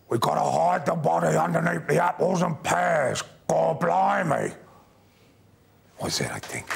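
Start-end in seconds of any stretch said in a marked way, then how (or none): 0.1-4.5 put-on Cockney accent. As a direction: play a Cockney villain, Cockney to the bone. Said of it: "Let me try it out on you".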